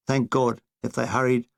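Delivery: plain reading voice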